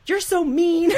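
high, loud mock teen whine; squeaky hammed-up You're so mean; whiny Corey. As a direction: whine it like a sulking teenager. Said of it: "Like that? Did he say that?".

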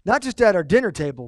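normal talking voice

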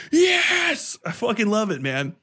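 ordinary talk, nothing marked

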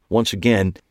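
usual speaking voice

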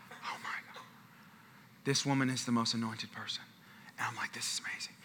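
awestruck near-whisper; whispered amazement, retold; hushed awe. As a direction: breathe it out, marvelling.

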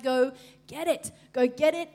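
loud urging, pushing them on; urging shout. Retold or imitated. Preacher voicing God urging his people loudly. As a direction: urge them to go get it.

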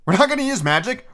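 put-on a high, indignant boy's voice in a mild read; a lightly voiced teen blurting it out; Ron's outburst. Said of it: "Ron ejaculated loudly".

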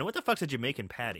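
ordinary talk

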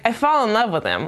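usual speaking voice

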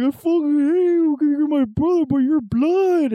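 very high, drawn-out weepy wail; imitated drunken crying. Crying drunk hugging his brother, hammed-up sobbing. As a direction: blubber it slowly in mock tears. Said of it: "the other one's crying".

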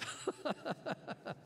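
breathy, voiceless laugh; chuckle. Real, not acted. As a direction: chuckle to yourself after the joke. Joking about cinnamon rolls.

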